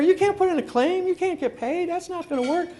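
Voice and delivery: emphatic advice to the neighbor; insistent. Retold or imitated. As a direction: urge it, then drop off. Telling neighbor not to pay.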